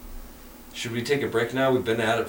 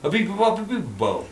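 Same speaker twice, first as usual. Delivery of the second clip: mock Italian accent; 6 semitones higher than usual. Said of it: "Don't you hear my stunning Italian accent?".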